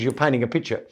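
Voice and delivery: ordinary delivery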